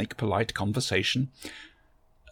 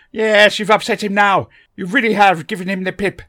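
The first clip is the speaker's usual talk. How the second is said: put-on higher and louder, fussy old gentleman; eccentric Doctor voice; 10 semitones higher, 13 dB louder than usual. A narrator's reading. The Doctor greets Barbara from behind, a higher, louder fussy old gentleman. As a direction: bustle in as a fussy, kindly eccentric.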